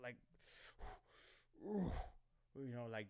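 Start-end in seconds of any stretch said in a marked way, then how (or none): 1.6-2.2 hard exhale. Shown as a demonstration. Lifting at the gym, a forceful exhale. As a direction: push the breath out hard. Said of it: "breathing out hard".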